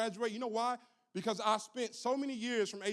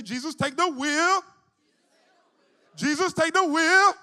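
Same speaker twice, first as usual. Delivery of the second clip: slow, much louder chant; loud call and response; 8 semitones higher, 13 dB louder, slower than usual. Shown as a demonstration. Preacher leading congregation in repeating, loud and slow. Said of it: "say it with me again".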